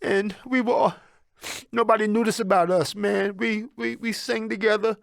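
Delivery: halting, choked-up memory; voice breaking. Real, not acted. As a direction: talk through tears about a beloved father. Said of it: "I'm about to get emotional".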